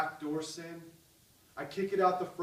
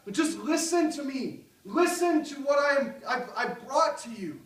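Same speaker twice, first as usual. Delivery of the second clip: crying out; 8 semitones higher, 6 dB louder than usual. Acted. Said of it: "He cried out. He said".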